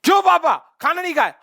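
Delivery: high, loud scolding question; daughter's shout. Retold or imitated. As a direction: confront dad loudly. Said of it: "इनकी बेटी ने कहा".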